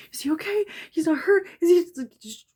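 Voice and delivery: high, loud, broken-off questions; acted-out panicking mom at the ER; frantic panic. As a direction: squeak out urgent, frantic questions. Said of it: "in a frantic panic".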